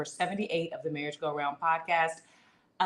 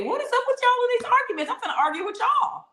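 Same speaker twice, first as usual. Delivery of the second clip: much higher, loud playful challenge; mock tough voice; 5 dB louder than usual. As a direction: act like you're picking a fight with the chat. Mock-confronts the chat.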